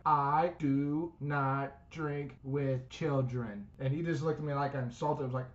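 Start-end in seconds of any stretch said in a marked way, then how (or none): 0.0-3.6 very slow, deliberate refusal; slow dry put-down to the college kids; slow deadpan. Retold or imitated. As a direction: spell out each word slowly and flatly. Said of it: "And I say this".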